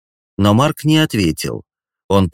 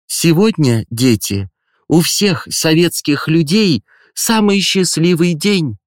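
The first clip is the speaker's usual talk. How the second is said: high, solemn school director speech; theatrically solemn headmistress; 7 semitones higher than usual. Acted. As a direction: proclaim it with theatrical ceremony. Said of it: "с театральной торжественностью объявила директор школы".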